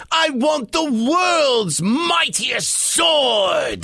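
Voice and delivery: high, slow grand demand; commanding pirate. Acted. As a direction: draw out a greedy wish to the stone. Pete commands the stone.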